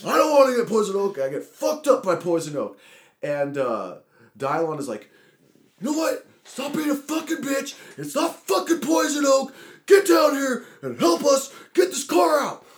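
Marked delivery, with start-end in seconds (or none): 0.1-2.8 high, loud whining objection; acted alarmed protest. Allergic friend protesting poison oak to the others, acted alarm. 5.8-12.6 high, loud exasperated yelling; acted angry order. Friend in the ditch ordering the reluctant one down, acted anger.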